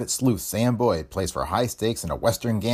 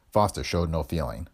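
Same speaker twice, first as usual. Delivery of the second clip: usual speaking voice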